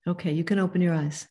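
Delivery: calm instruction to close the eyes; guided meditation. Acted. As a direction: ease them into stillness, unhurried. Leads a short guided meditation.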